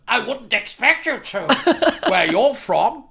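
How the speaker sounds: slow, laughing put-on toff voice; snooty heckler. Second heckler sneering at his roots, slow laughing sneer. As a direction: sneer it slowly through a laugh. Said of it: "this other fellow went".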